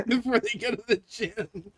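laughing through words. Real, not acted. Finishing the shirt joke to co-host, high and laughing. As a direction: barely get it out for laughing.

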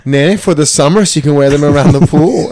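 a mother explaining sweetly; man doing a woman's voice. Retold or imitated. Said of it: "your mom impression".

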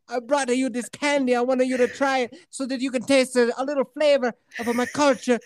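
put-on high, earnest boy with Italian accent; mock Italian-sounding kid. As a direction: imitate a proud kid offering a treat, Italian-accented. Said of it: "he comes up and he's like".

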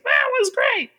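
put-on high little kid voice; squeaky excited little boy. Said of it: "The one who's like".

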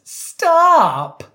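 high, loud, drawn-out cry; exaggerated squawk of protest; mock outcry. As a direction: imitate a dramatic high-pitched yell. Acting out Victoria's quoted reaction.

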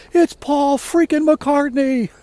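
high, loud, drawn-out shout; excited fan yell. Retold or imitated. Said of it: "she yells out".

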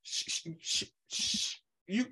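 soft shushing hiss; hushing whisper. Retold or imitated. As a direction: shush them like a gossip leaning in. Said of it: "Like, they like".

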